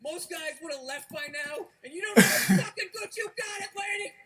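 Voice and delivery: laughing mock yell as dad; half-laughing, bellowing the catchphrase; dad's catchphrase. Dad barking his catchphrase at mom, laughing yell. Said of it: "That was one of his catchphrases".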